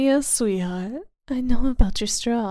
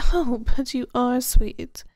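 ordinary delivery

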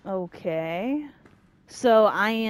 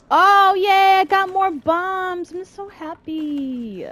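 high, drawn-out fake cheer; hammed-up sarcastic yay; mock delight; 10 semitones higher, 6 dB louder, slower than usual. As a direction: overdo the joy, loud start then trailing off. Mock joy at getting more bombs.

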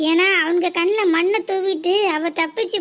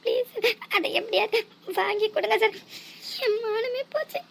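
crying plea; 4 semitones higher, 5 dB quieter than usual. Acted. Girl begging sir through tears.

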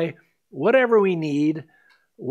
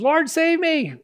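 a high, desperate cry for help; a sinking man begging to be saved; panicked plea; 11 semitones higher than usual. Retold or imitated. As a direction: Peter sinking in the storm, crying out in panic. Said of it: "he starts freaking out".

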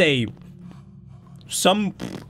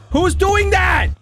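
high, loud frightened demand; alarmed shout; 12 semitones higher, 5 dB louder, slower than usual. Real, not acted. Player hears breathing nearby, high scared shout. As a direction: call out in panic, drawn out.

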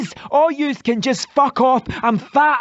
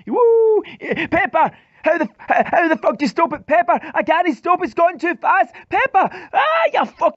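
high panicked shouts, fast; panicked yelling; 8 semitones higher than usual. Acted. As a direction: scream for help on a runaway bike. Runaway bike panic.

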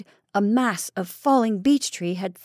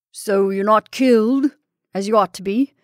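loud taunting cry at a trapped rival; gloating yell at his pinned enemy; cried out; 6 dB louder than usual. Acted. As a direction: shout it out in triumph. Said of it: "he cried".

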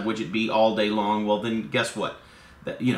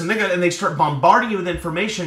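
normal talking voice

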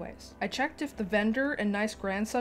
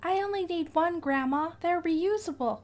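nice grandson; 8 semitones higher than usual. Acted. Grandson voiced high.

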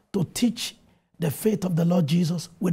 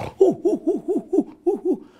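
put-on excited child's hooting; 10 semitones higher than usual. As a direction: hoot like a thrilled little boy waking up. Boy wakes up excited at dawn.

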